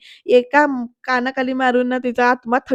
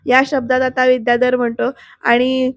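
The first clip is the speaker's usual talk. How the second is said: ordinary talk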